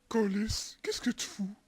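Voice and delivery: urgent hushed whisper. Real, not acted. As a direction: hiss it so no one hears.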